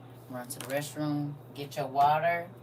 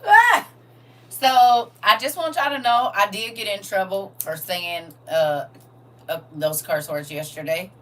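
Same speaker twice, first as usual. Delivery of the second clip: much louder and higher, bursting out; loud outburst; 5 semitones higher, 15 dB louder than usual. Real, not acted. Host suddenly confessing to viewers, loud and excited.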